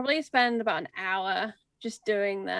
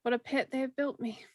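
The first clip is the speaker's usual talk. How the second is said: quiet, hushed remark to oneself; softly spoken private aside; soft in-character musing; 3 semitones higher, 5 dB quieter than usual. Acted. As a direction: murmur it softly on seeing the place. Character muses on the arena.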